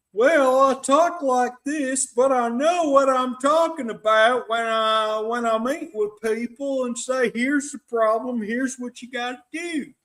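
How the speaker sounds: high mock Texan voice of a TV advisor; Doctor Phil Southern accent. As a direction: play up a folksy Texan accent, speeding up near the end. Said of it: "a guy from Texas".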